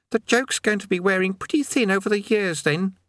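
put-on high young boy's voice; lightly high-pitched youth voice; boy Eric.

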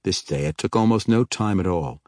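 usual speaking voice

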